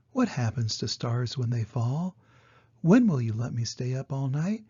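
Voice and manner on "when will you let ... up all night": usual speaking voice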